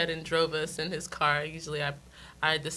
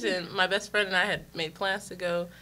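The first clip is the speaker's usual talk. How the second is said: ordinary delivery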